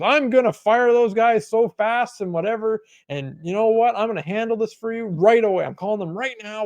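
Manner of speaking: mock-fired-up promise to an upset customer; raising his voice. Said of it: "he starts, like, raising his voice".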